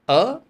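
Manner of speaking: vowel â said high and clear; Vietnamese vowel demo. Shown as a demonstration. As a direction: model the vowel for students to copy. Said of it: "try to match my tone".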